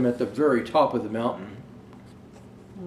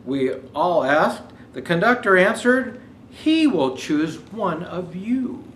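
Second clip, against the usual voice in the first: put-on storybook reading for children; gentle read-aloud to kids; story narration; 5 semitones higher, 5 dB louder than usual. Reads picture book aloud.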